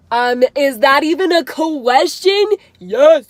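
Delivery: loud, emphatic acted reply; excited yes. Fan answering an offer to meet him, excited.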